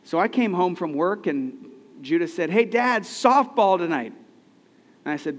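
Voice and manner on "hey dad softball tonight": higher, louder, bursting with excitement; a boy who can't wait for the game; eager kid. Retold or imitated. Son reminding his dad, higher and louder with excitement.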